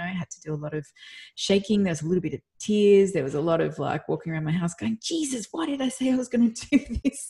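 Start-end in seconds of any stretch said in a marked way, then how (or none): 5.0-7.3 panicked groan. Retold or imitated. Pacing at home, panicking before talk.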